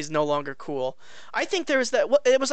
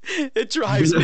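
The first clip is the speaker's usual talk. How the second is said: ordinary talk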